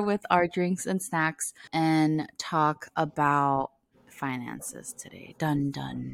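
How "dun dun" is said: whispered suspense sting, dun dun; a hushed, playful ominous sting; dramatic dun dun. Pretend. Mock suspense sting about finances.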